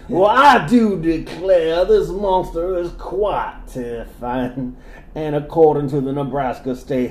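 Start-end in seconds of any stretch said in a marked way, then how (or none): 0.1-4.8 put-on southern accent. Reading in southern voice.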